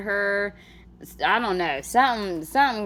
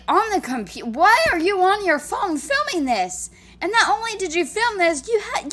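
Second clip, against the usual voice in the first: worked-up, raised-pitch disbelief; incredulous rant; 6 semitones higher than usual. Real, not acted. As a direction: rant in real disbelief, pitch riding high. Incredulous rant about filming the meltdown.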